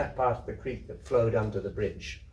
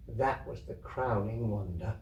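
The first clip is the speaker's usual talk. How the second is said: usual speaking voice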